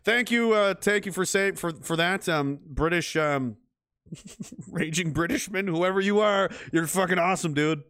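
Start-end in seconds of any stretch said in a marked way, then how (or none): none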